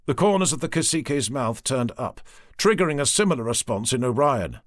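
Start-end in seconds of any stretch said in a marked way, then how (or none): none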